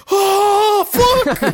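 loud high yelp of pain; hammed-up cry as if bitten; mock pained yell. Pickle thrown down by T-Rex Baki, loud mock yelp.

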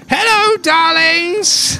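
high, gushing camp diva voice; campy drag queen. Acted. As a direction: greet them like a flamboyant drag queen. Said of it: "and she sees your car and says".

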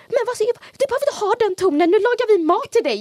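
high, fast scolding mom voice; a mock-stern mother scolding her child; imitating mom. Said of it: "Och mamma var så här".

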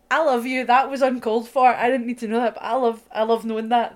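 loud, high and gushing; overwhelmed with affection. Retold or imitated. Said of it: "I was like".

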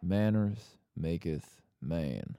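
slow, drawn-out put-on accent; Pedro Pascal. Host offers the second film's motto, slow accented impression. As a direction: stretch each word in an accented drawl. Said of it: "I could be Pedro Pascal".

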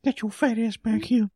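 put-on high cranky old man; high-pitched old geezer yelling; creepy old man voice. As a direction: bark it like a grumpy old man. Continues old man impression.

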